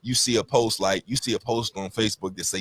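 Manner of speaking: ordinary delivery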